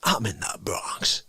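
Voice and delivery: hushed, low mob phone message; low whisper. Acted. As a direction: breathe it like a quiet threat.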